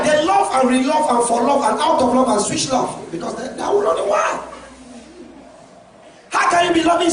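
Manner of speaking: loud, high shouted preaching questions; fiery preaching. Acted. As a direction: drive the questions at the congregation. Preaching at full force.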